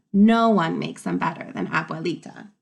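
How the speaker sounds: ordinary talk, nothing marked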